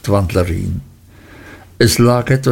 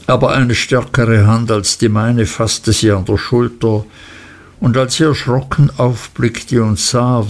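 plain reading voice

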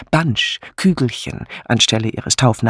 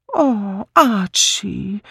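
hohes, gedehntes Gejammer; jammernd; 8 semitones higher, much slower than usual. A narrator's reading. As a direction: jammere flehend und langgezogen. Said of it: "stieß Misses Easterbrook jammernd hervor". (quengelnd klagende Ehefrau)

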